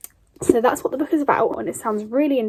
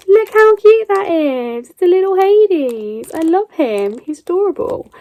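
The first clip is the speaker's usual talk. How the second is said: cooing over something cute; 8 semitones higher, 5 dB louder than usual. Real, not acted. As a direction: gush over it like a cute pet.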